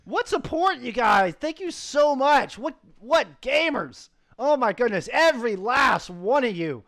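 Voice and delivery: high, loud grateful gushing; excited thanks. Real, not acted. Caster thanking viewers with high excitement. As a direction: gush thanks to the whole chat.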